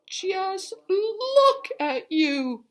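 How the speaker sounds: very high, loud, slow outraged cry; scolding scream. A narrator's reading. Missus Wishy-Washy scolding the filthy animals, high loud shriek. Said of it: "she screamed".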